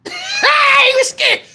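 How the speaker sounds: high, drawn-out imitated wail; mimicked scared sobbing; mock sobbing. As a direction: wail like a crying kid, hammed up. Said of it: "cousin was in the back sobbing like".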